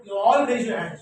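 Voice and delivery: ordinary talk, nothing marked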